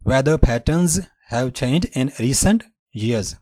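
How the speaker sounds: very slow example sentence; slow demo. Shown as a demonstration. Teacher reading an example slowly for students. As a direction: read it out word by word. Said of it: "پیٹرن، پیٹرن".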